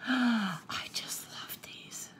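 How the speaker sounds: slow, soft whisper after a gasp; hushed, lingering adoration; awed whisper. Real, not acted. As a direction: breathe it out, overcome with love. Awed by gift, gasps first.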